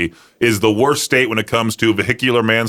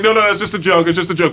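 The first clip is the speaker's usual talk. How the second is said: higher, hurried mock protest; son backpedaling; 6 semitones higher, faster than usual. Son to his angry mom, backpedaling. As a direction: imitate a son pleading it was a prank. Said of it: "the son's like".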